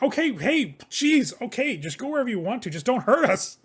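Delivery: high, loud, flustered pleading; panicked servant. Acted. Thumped servant to old woman, high panicked surrender. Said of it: "comes from one of the servants".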